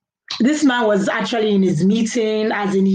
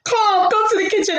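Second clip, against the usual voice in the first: put-on high, summoning mother calling a child; calling mom; 12 semitones higher than usual. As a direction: call the kid down like an impatient mom.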